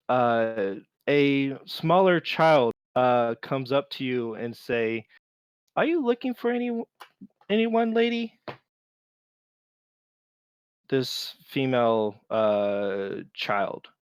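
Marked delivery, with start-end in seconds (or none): 5.7-8.4 put-on high, quick, eager little-girl voice. GM voices small girl asking the lady, high child voice.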